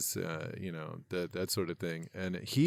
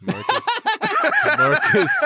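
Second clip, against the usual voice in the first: laughing squeal; 5 dB louder than usual. Real, not acted. Laughing high call.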